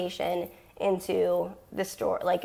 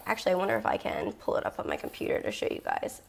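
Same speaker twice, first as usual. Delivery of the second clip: voiceless, worn-out voice; the voice fading to air; losing her voice; 4 dB quieter, faster than usual. Real, not acted. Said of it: "my voice is gone".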